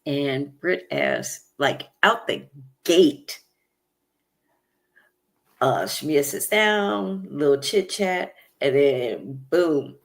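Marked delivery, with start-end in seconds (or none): none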